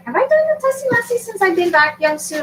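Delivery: sing-song high question; teasing high-pitched ask; high playful voice. Real, not acted.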